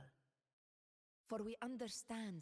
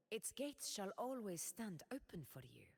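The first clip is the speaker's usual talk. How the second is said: usual speaking voice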